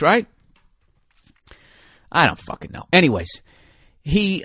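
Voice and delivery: ordinary talk, nothing marked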